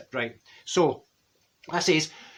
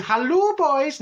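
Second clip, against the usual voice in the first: high, loud hello called down to the river; shouted greeting; 10 semitones higher, 4 dB louder, slower than usual. Retold or imitated. Greeting the swimming boys from the bank, shouted high. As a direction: call down cheerily to the boys. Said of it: "we shouted down".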